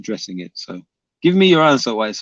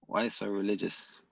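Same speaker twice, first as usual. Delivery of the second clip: much softer, lower, under the breath; muttered repeat; 4 semitones lower, 14 dB quieter than usual. Real, not acted. Muttering the taunt again to himself, quiet and lower. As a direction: repeat the tease to yourself, barely aloud.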